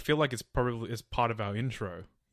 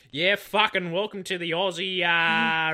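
loud, higher broad Aussie bogan parody; mock bogan Aussie; 6 semitones higher, 7 dB louder, slower than usual. Co-host mocking their podcast intro in a loud bogan accent. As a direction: ham up the ocker show host. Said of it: "we start off every episode going".

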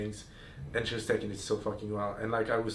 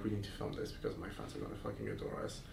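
low voice; 12 semitones lower, 10 dB quieter than usual. Retold or imitated. Retold eager suggestion.

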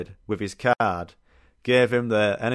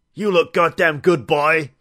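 slow, loud, higher gruff dwarf impression; Gimli voice; 6 semitones higher, 6 dB louder, slower than usual. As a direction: play the dwarf sizing you up, slowly. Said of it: "Of course he talks like him".

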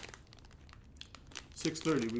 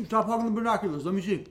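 higher, louder eager complaint; eager protest; 7 semitones higher, 7 dB louder than usual. Real, not acted. Friend demands a turn with the binoculars, loud and eager. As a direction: reach for it, impatient.

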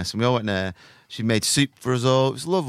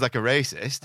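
usual speaking voice

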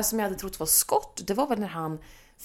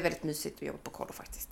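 whisper; 6 semitones lower, 12 dB quieter, faster than usual. Real, not acted. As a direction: hush it like a secret. Whispering about camp. (viskar om kollot)

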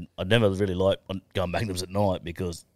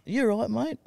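woman's voice. Retold or imitated. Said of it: "This duck comes up to me and goes".

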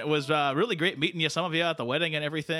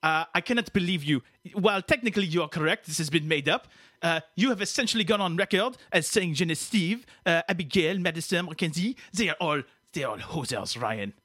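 put-on indignant French-Canadian accent; hammed-up French-Canadian outrage; Quebecois [name]; 2 semitones higher than usual. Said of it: "let Quebecois [name] do it here".

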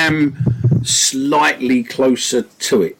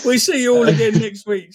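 laughing; 10 semitones higher than usual. Real, not acted. Laughs through the sign-off.

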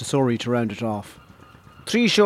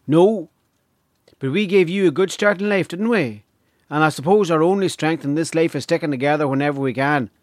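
higher-voiced Donegal hostess; earnest Donegal woman making her case; 3 semitones higher than usual. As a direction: lift the pitch as a rural Donegal woman.

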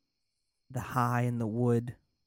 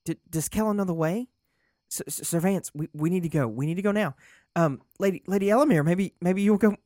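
much higher and louder, stammering panic; frantic urgency; 8 semitones higher, 8 dB louder, faster than usual. Acted. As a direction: beg them to go right now. Lorik frantic to leave.

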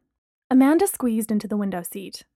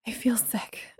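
soft whispered, much quieter; breathed whisper; 8 dB quieter, slower than usual. A narrator's reading. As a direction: breathe it out weakly. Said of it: "Amanda breathed".

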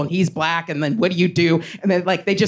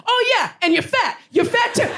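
taunting heckler. Retold or imitated. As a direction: taunt like a schoolyard bully. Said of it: "He's like".

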